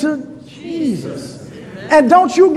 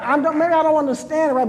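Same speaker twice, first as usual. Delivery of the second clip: normal talking voice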